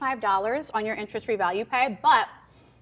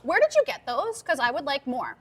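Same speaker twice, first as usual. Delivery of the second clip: high, sweet imitated customer voice; polite customer asking; 7 semitones higher than usual. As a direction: ask politely in a higher, lighter voice. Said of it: "they're gonna say".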